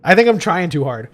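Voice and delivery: usual speaking voice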